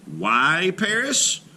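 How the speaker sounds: ordinary delivery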